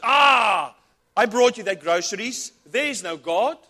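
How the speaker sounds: loud, high taunting yell; spring out and crow at her; gloating shout. Acted. Atheist leaping from the bush to mock her, loud gloating yell. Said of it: "jumps out from behind the bush and says".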